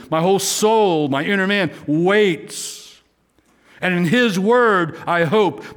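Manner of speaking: high, slow, swelling preacher's reading; fervent scripture reading. Acted. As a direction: pour the psalm out like a plea. Preaching the psalm text.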